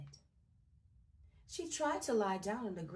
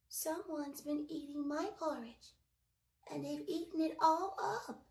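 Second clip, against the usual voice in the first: high little cub voice; tiny Baby Bear voice; 6 semitones higher than usual. A narrator's reading. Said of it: "he cried in his teeny, tiny voice".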